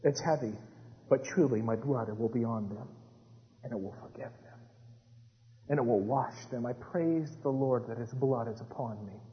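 soft, low, heartfelt thanks; humbled, low-voiced devotion; hushed reverence. Real, not acted. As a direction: drop to a quiet, prayerful near-murmur. Preacher turns to heartfelt personal thanks.

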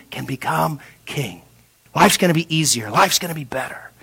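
whispered temptation. Acted. The enemy luring David, whispered. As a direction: hiss it like a tempter in the ear.

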